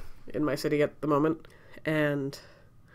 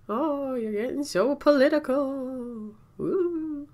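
high, drawn-out spooky wail; mock ghost; 9 semitones higher, much slower than usual. Mocking imagined critics of "get your jabs", mock ghost voice. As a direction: moan it slowly like a cartoon ghost. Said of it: "I don't know why they're ghosts".

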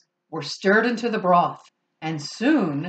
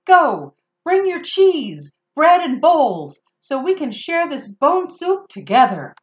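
calling out; 6 semitones higher, 5 dB louder than usual. Acted. Finnegan calls out to town.

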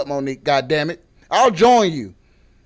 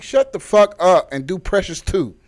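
ordinary delivery

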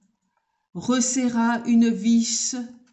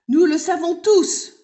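put-on higher, louder kids chanting together; chorus of schoolchildren; 8 semitones higher, 8 dB louder than usual. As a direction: call it out proudly as a group. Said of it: "reprirent en chœur les écoliers".

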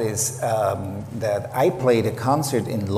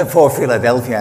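louder, still laughing through the words; 9 dB louder than usual. Real, not acted. Laughing after the story.

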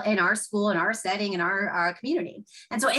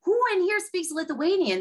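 higher eager question to his son; thrilled father asking his kid; excited dad; 9 semitones higher than usual. Retold or imitated. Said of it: "his dad's so excited".

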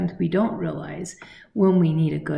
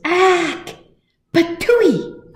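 much higher, loud spluttering spit; spluttering hippo; 13 semitones higher, 10 dB louder, much slower than usual. Acted. Hippo spitting out bugs, high and loud splutter.

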